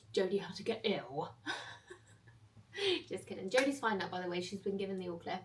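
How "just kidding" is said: laughs it off, teasing; laughing. Real, not acted. Teasing viewers after a fake-out, laughing. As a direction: giggle through the words.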